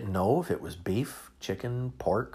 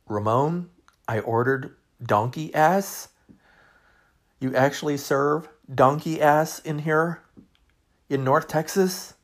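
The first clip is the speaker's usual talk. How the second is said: incredulous; 6 semitones higher, 6 dB louder than usual. Retold or imitated. Dad protesting to waiter.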